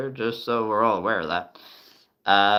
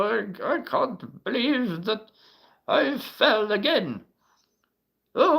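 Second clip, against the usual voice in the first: put-on high old man, fallen and calling; old man calling for help; grandpa voice; 8 semitones higher than usual. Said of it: "My best grandpa voice?".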